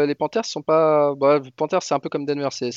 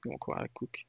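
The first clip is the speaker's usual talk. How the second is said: soft unvoiced whisper; whispered aside; 13 semitones lower, 17 dB quieter, faster than usual. Real, not acted. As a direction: drop to a whisper for the repeat. Repeats the point quietly.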